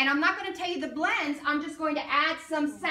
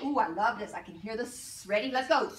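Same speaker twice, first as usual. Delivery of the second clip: ordinary delivery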